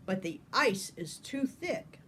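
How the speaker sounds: ordinary delivery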